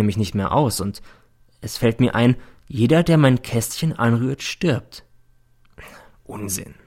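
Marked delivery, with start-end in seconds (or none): 6.2-6.7 murmured under the breath, much softer; barely voiced brush-off; a quiet, shaken denial; hushed dismissal. A narrator's reading. Dismissing a wild thought.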